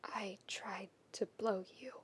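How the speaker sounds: hushed, breathy whisper of shame; whispered confession. Acted. Keith confessing to Shiro in a whisper. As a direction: barely voice the words.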